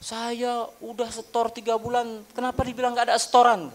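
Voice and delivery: angry customer. Retold or imitated. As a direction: mimic an upset customer nagging over her deposits. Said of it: "marah, dia merepet".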